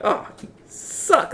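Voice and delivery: slow breathy aside, no voice; teasing whisper. Retold or imitated. Viewer whispering a tease at two shy lovers. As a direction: whisper it like a tease nobody should hear.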